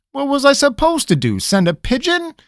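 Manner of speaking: a cornered, exasperated retort. Acted. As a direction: throw up his hands. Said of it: "in exasperation".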